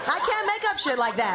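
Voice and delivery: ordinary delivery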